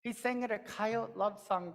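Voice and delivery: ordinary delivery, nothing marked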